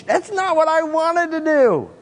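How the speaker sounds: high-pitched, hammed-up protest; exaggerated high wail of reluctance; mock protest. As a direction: blurt it out high, as a comic objection. Confessing urge against what love required.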